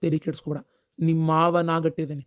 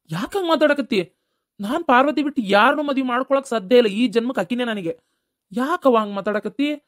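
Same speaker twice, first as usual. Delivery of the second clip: heated protest; 6 semitones higher, 4 dB louder than usual. Acted. Son protests to his mother.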